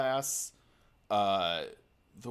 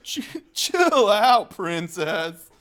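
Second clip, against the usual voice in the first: high, slow laid-back surfer; surfer dude voice; 6 semitones higher, 8 dB louder, much slower than usual. Pretend. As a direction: drawl it like a chill surfer. Surfer Link voice.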